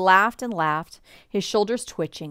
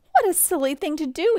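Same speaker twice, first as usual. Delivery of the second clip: high, quick teasing exclamation; amused chiding; 9 semitones higher, 3 dB louder, faster than usual. A narrator's reading. Pa chiding mama over the empty sack, amused chiding. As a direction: exclaim it briskly, pitched up, gently scolding.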